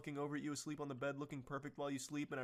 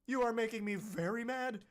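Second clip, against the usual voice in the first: louder and higher, acting out a furious demand; mock angry rant; 5 semitones higher, 14 dB louder than usual. Reading Brandon's angry texts to Ruby, mock furious. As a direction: voice the texter losing his temper, raised and pitched up. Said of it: "So he's going full mask off".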